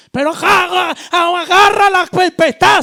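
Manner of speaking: loud, high exasperated cry; urgent demand. Retold or imitated. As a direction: beg him to grab the storm now. Disciples urging Christ to act. (los discípulos le gritan a Cristo que detenga la tormenta)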